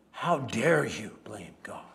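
slow, hissed whispered rebuke; fierce whisper. Acted. Sarah rebuking Josiah, hushed and intense.

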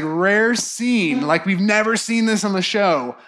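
ordinary talk, nothing marked

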